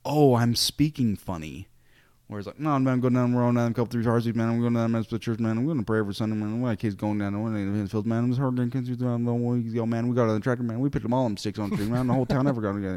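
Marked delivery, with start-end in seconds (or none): 0.1-1.7 self-mocking realization. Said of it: "in order to be like". 2.6-12.9 put-on louder, drawling rural Iowa accent with man on every line. Missionary showing his friend the rural Iowa accent he picked up. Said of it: "I totally developed the accent".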